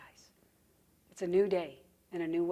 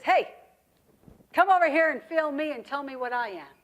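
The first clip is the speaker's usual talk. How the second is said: louder, higher snake call; calling over; 4 semitones higher, 6 dB louder than usual. Acted. Snake summons the rabbit to feel him, calling out. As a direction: call out eagerly to someone.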